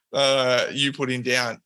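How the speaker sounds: ordinary delivery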